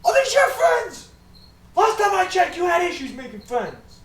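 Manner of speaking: high, loud, slow fatherly scolding. Acted. Father scolds his daughter Lil about friends, high loud voice. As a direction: bellow at your daughter.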